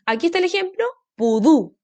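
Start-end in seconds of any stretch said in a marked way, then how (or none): 1.1-1.8 "pudú" with the voice loaded on DÚ; stress on last syllable. Shown as a demonstration. Teacher shows students the example, "pudú" stressed on the end. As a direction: lean on the final syllable of "pudú". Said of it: "Se carga la voz, última sílaba".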